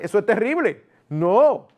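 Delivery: ordinary delivery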